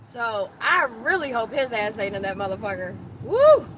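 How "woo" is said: whooping cheer, much higher and louder; real excited holler; loud high woo. Real, not acted. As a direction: let out a big excited woo.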